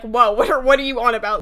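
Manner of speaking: loud, mock-baffled retort; taken aback. Rider retorting to the woman's accusation, taken aback and loud.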